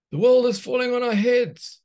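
high, loud panicky cry; panicked shout. Acted. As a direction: yell as the sky caves in. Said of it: "shouted Dopamine".

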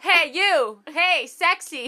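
loud, high-pitched mock catcall; catcaller. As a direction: call it out like a hammed-up street flirt. Acting out a street catcall.

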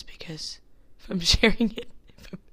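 laughing nervously through the words; nervous laughter. Real, not acted. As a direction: giggle while you speak. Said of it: "I don't know why I'm laughing".

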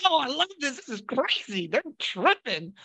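high, thrilled "Oh, I love this"; excited delight. Retold or imitated. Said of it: "I'm like".

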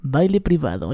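low announcer voice; deep, unhurried club MC; strip-club DJ. Shown as a demonstration. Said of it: "yo era D J de los que hablan".

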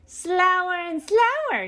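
high, drawn-out character holler; yelling. A narrator's reading. Beasley scolds the workers for slowness, yelling. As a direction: stretch it out in a high-pitched yell. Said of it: "he yelled".

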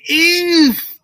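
"if," hit very high and held; "if," leapt up in pitch for emphasis; stressed if. Shown as a demonstration. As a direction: lean hard on "if,". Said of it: "I'm gonna stress this word".

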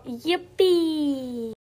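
high, drawn-out cheer of joy; excited cheer. Acted. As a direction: let out a long gleeful yippee. Child cheers about coming birthday. (begejstret, langtrukket jubelråb)

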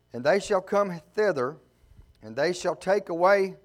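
measured, rising preacher's scripture reading; a preacher reading Ezekiel aloud. Acted. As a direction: proclaim the verses to the congregation.

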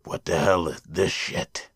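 low, slow, hushed disbelief; disgusted inner voice. Acted. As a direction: think it to yourself, deep and drawn out. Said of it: "in the back of your mind".

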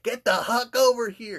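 loud, high mock yell; yelling at a kid. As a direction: holler for the kid, hammed-up.

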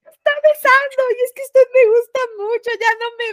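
voz muy aguda y llorosa, más fuerte; llorando imitado. Retold or imitated. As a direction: lloriquea confundida tras el beso. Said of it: "ya llorando". (empleada llorosa al jefe que la besó, chillando agudo)

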